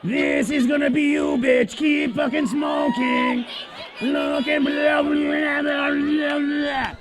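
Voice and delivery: flat mechanical buzzing monotone; monotone robotic voice box; robotic throat-box voice. Retold or imitated. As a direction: speak through an electrolarynx. Keeps mocking electrolarynx voice.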